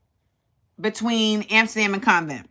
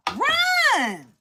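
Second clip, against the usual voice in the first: a sudden much higher cry to run; urgent yell; 4 dB quieter than usual. Retold or imitated. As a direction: yell at him to get going. Urging the man to flee.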